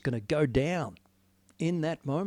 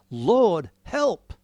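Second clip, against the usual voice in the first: desperate plea; 3 semitones higher, 7 dB louder than usual. Acted. Desperate cry to a sleeping Jesus.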